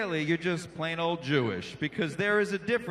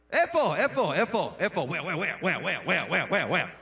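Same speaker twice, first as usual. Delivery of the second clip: repeating Israeli; 2 semitones lower than usual. Retold or imitated. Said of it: "They'll say a Hebrew word, like, eight times".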